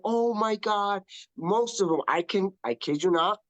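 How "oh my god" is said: higher, delighted surprise; a hint of touched surprise; surprised gasp. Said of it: "They're like".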